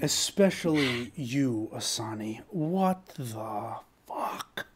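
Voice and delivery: very slow, whispery reproach; Shadow Broker voice. Acted. Shadow Broker singles out Asani, slow breathed disbelief. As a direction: let each word drag out, half-whispered. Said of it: "voice of the Shadow".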